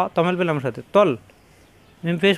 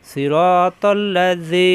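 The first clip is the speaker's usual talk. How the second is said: slow model reading; much slower than usual. Shown as a demonstration. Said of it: "teacher models the word slowly".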